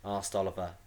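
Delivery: ordinary delivery, nothing marked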